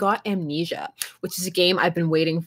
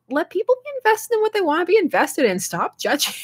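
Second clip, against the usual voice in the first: exasperated plea; 10 semitones higher than usual. Retold or imitated. Defending crying soccer fans, higher-pitched exasperated plea. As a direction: pitch jumps higher, pleading for people to stop judging. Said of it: "And I'm like".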